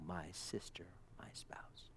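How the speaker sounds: very soft, sinking into a whisper; intimate hush. Retold or imitated. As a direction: murmur it low like an endearment. Voicing bridegroom's tender address.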